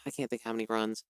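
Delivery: usual speaking voice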